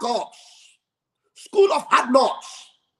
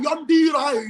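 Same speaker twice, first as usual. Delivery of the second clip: ordinary delivery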